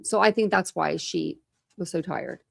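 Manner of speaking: ordinary talk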